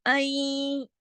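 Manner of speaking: आई drawn out partly through the nose; n of आई half heard, half merged; slightly nasal. Shown as a demonstration. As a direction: let the end of आई hum lightly in the nose. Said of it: "थोड़ी आवाज नाक में जा रही".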